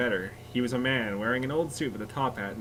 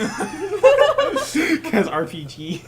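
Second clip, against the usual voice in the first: laughing; 6 dB louder, much slower than usual. Real, not acted. Joking about RPG daggers.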